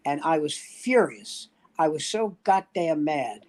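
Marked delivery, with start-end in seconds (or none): none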